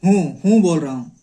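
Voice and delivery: हूँ said with a long nasal oo, not हो; teacher demonstrating the nasal हूँ to students; nasal hoon. Shown as a demonstration. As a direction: stretch हूँ into a nasal uun sound. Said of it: "हूँ और हूँ में अंतर है".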